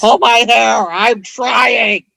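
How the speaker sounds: high, hammed-up struggling dinosaur; straining T-Rex. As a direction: cry out as if failing to reach. Acts out short-armed T-Rex straining.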